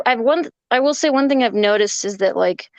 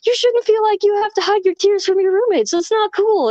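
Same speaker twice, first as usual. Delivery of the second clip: pep talk; 10 semitones higher than usual. Retold or imitated. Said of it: "And they were like".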